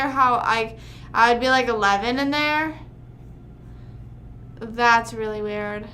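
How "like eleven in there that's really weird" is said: ordinary talk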